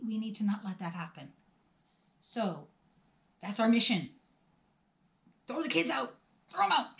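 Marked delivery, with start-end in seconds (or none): 5.5-7.0 high, loud battle cry. Real, not acted. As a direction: call the troops to arms. Rallying call.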